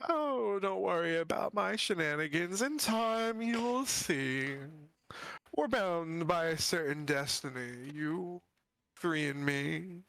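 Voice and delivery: creepy sing-song undead voice; Lich voice. Acted. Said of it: "You sound different".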